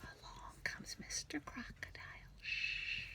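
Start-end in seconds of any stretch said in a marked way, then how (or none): 0.0-1.7 whispered, sneaking; suspense whisper. Acted. Teacher reading to children as the crocodile creeps up, whispered.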